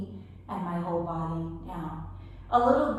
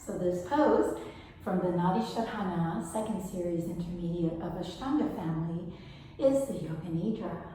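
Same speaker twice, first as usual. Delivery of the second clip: normal talking voice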